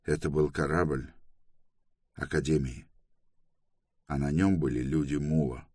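subdued, resigned announcement; doomed. A narrator's reading. Toran telling the gathered crew bad news, doomed. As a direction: report it as if all is lost, understated. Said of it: "Он обречённо сообщил".

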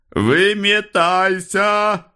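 high, long-drawn shout; barked order. A narrator's reading. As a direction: bellow it at a passenger, stretching the word. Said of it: "гаркнул водитель". (гаркнуть, высоко и протяжно)

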